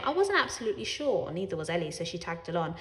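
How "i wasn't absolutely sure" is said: usual speaking voice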